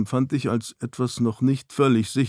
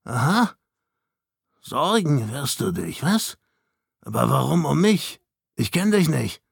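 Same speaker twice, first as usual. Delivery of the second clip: higher, strained, suspicious figure voice; dazed Snow; 3 semitones higher than usual. A narrator's reading. Snow disowning the man who worries about him, dazed. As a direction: push each question up, warily rejecting him. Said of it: "sagte er dumpf".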